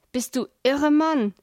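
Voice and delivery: outraged challenge; indignant cry. A narrator's reading. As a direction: exclaim it in indignant disbelief. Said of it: "rief Hans empört".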